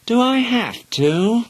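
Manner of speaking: put-on high, slow whining child; whiny. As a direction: whine the same question again. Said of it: "asked Helen again".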